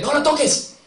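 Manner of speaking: high, loud cry to stop them; shouted command. Retold or imitated. Young man shouting at the neo-Nazis not to touch the Torah. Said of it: "y decía él".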